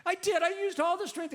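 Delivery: put-on high, loud little boy protest; whiny boy. Little boy to his father, high and whiny protest. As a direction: insist it like a frustrated kid.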